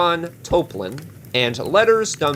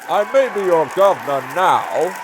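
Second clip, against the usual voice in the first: rabble-rousing small-town mayor; 4 semitones higher than usual. Acted. Small-town mayor rallying his voters against comics, fiery stump speech. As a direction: whip up the crowd like a fearmongering politician. Mayor now governor addresses crowd.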